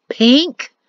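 PINK rising in disbelief; incredulous question. Acted. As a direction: ask "Pink?" with a disbelieving rise. Perrin holding up pink banner.

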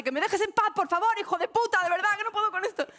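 high, loud and desperate; pleading yell. Retold or imitated. Hitting her instructor, a high pleading yell. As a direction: beg and insult at the top of your voice. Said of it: "me sale".

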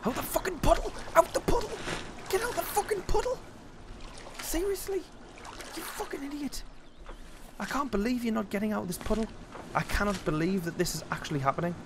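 high, loud exasperated yelling; frustrated shouting. Real, not acted. Gamer yelling at his character stuck in a puddle, high furious shouts. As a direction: scream at the game in disbelief.

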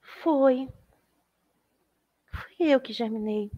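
a small bird confessing quietly; shy little bird. Acted. Little bird answers shyly.

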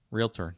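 Realtor as two syllables, REEL-ter; standard pronunciation. Shown as a demonstration. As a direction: say Realtor cleanly, no extra vowel in the middle. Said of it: "Did I say realtor?".

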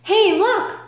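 high, loud excited call; holler. Acted. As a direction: call friends to look. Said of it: "hollered Ant".

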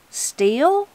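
high, rising startled echo; startled question. Acted. Granny catches her slip about the still, startled. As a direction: blurt it as a sharply rising question.

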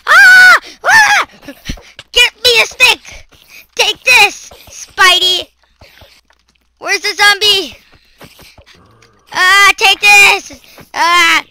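high loud shouts while fighting; frantic battle yells. Real, not acted. Gamer hitting a spider, frantic yelling. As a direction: shout each blow at the monsters.

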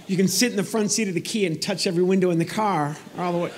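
normal talking voice

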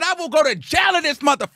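high, fast, defiant outburst; heated defiance. Retold or imitated. Refusing to pay in the restaurant, defiant. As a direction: fire it off at a high pitch, fed up.

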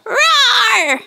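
high pretend roar; mock kid-show roar; playful dinosaur roar. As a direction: roar like a toy dino. Red dinosaur roars.

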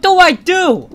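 panicked. Real, not acted. Panic in chase.

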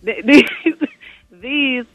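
laughing. Real, not acted. Laughs while starting sentence.

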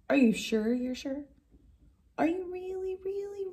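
ordinary delivery, nothing marked

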